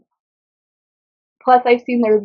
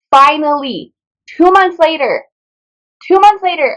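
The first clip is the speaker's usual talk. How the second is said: rising, louder exclaim building on the repeat; excited exclaim; 5 semitones higher, 4 dB louder than usual. Real, not acted. Reviewer tells viewers she escaped her slump, excited louder and higher. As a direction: cry out in relief to the viewers at finally escaping the slump.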